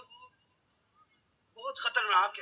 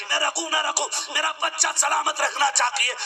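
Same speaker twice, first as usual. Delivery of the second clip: higher, shouted repeat of the plea; the mother's sacrifice hammered home in a shout; mother's plea cried out; 12 semitones higher, 6 dB louder than usual. Retold or imitated. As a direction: cry it out as the mother willing to die. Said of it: "ماں کی زبان سے جملہ نکلتا".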